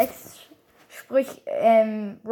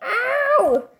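high, stretched "ow"; mock-hurt game character cry; imitated pain yelp; 10 semitones higher than usual. Player echoing a brawler's hurt sound, high mock yelp.

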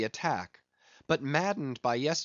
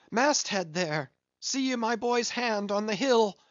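high, loud hail aloft; shouting up the mast; 8 semitones higher, 3 dB louder than usual. A narrator's reading. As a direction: call up to the lookout. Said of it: "hailing the masthead".

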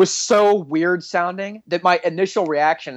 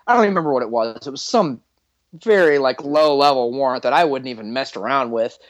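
ordinary talk

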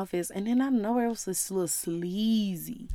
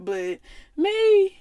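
playful pleading; 6 semitones higher, 3 dB louder than usual. Real, not acted. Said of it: "playful begging for love".

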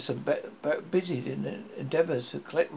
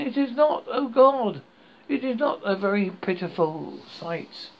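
high, louder despairing wail; despairing cry; 5 semitones higher, 4 dB louder than usual. A narrator's reading. Buried dead crying out from graves, despairing high wail. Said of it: "a tumult of despairing cries".

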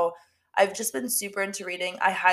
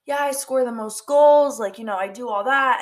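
cocky bragging; 4 semitones higher, 6 dB louder than usual. Retold or imitated. Cocky soccer player imitated.

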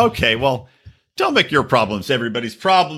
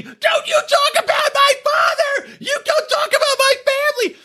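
mock screaming. Imitating Hannah screaming at Kyle about family, mock shriek. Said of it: "gets up and starts screaming".

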